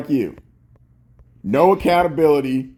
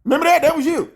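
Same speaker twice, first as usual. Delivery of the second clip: high mocking taunt, much higher; gloating taunt; 10 semitones higher, faster than usual. Real, not acted. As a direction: rub it in with a high sneer.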